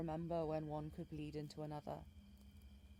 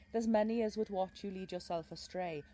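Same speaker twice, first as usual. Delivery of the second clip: ordinary delivery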